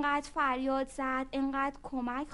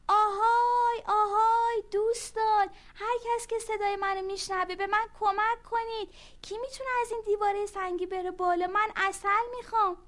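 loud, high calling out; shouting for help; 8 semitones higher, 7 dB louder than usual. Acted. Ant yelling up the stone wall for help. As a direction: call out to anyone who can hear. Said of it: "فریاد زد".

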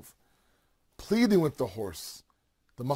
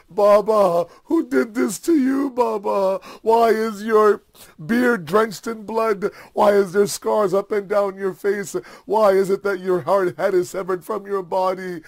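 loud, raised-pitch cries to a father; anguished lament; 5 semitones higher, 8 dB louder than usual. Acted. Daughter questions her slain father's head, anguished lament. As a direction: wail out the questions, stretching words.

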